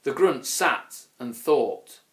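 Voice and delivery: ordinary delivery, nothing marked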